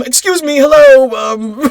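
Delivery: a hammed-up flustered plea to the guards; pleading his case. Shirley pleading from the jail cell, high mock pleading. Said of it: "he's pleading his case".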